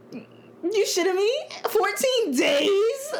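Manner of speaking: high, slow disbelief; incredulous. Real, not acted. Incredulous outburst.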